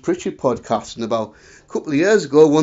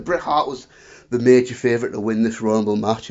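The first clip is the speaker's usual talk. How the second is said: ordinary talk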